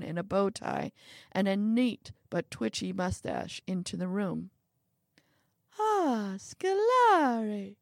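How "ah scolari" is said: high, drawn-out delighted cry; little man's effusive high-pitched hail; exultant greeting. A narrator's reading. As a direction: sing out the name grandly. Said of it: "exulted the little man".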